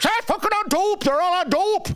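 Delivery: high, hammed-up grumpy Irish dad; imitating dad. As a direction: bark it up high like an old cranky father. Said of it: "my father didn't like".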